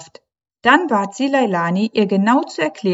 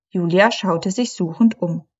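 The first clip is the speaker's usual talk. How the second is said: ordinary delivery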